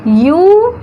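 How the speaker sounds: "यू" held long with a rising tune; isolated syllable. Shown as a demonstration. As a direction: stretch "यू" out on its own. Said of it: "कितना syllable?".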